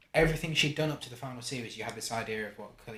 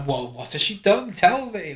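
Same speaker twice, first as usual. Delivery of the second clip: higher and louder, re-enacted surprise; mock astonished curiosity; 9 semitones higher, 13 dB louder than usual. Viewer re-enacting curiosity about Kira's past, mock astonished. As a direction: act out an eager, startled demand. Acting out own reaction as viewer.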